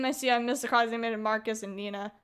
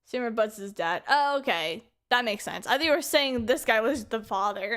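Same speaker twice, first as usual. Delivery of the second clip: usual speaking voice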